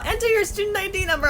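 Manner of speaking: high, singsong-like staffer voice; imitated staff request. Student mimics staff asking for ID number, high-pitched.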